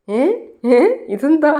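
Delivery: high, loud retold gasp of disbelief; stunned shock. Husband sees a fourth baby, high startled exclamation. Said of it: "ഞെട്ടലോടെ നിൽക്കുകയാണ്".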